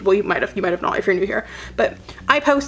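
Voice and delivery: usual speaking voice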